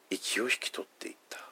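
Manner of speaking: breathless hushed close; hushed whisper. Acted. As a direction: whisper it as life fades. Narrating Jesus's last breath.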